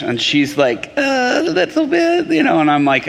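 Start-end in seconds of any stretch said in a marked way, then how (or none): 1.0-2.3 squeaky, high-held mock hesitation; high-pitched imitation. Waitress answering whether she speaks English, high mimicked voice. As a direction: imitate her reply way up high.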